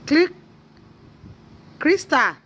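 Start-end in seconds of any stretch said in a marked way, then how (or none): 1.8-2.4 high, loud call to a pet. Real, not acted. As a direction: call the dog's name brightly. Calling out to the dog.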